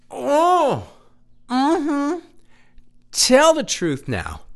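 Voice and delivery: high, drawn-out church amen; mock churchgoer. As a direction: egg him on like a preacher's flock. Mock church call-and-response.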